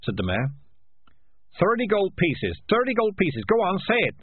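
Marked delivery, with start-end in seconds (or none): 1.6-4.2 high-pitched, urgent heckle; shouted urging. A narrator's reading. Voice at the back prompting a price, calling out. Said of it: "shouted the voice at the back".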